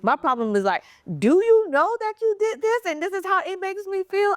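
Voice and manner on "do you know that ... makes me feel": higher, pointed question to the partner; confronting question. Retold or imitated. To her partner who hurt her, a pointed, higher questioning voice. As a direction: press him to admit what he did.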